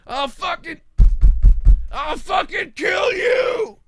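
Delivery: very high, slow, drawn-out mock shout; weird yell. Driver yelling back at a road rager, hammed-up high shout. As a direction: yell it out bizarrely to scare them off. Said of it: "Just yell something weird to them".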